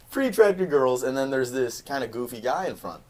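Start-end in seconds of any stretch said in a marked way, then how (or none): 0.1-1.0 put-on "Pretty attractive girls" said high through a laugh. Shown as a demonstration. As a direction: repeat it with a laugh.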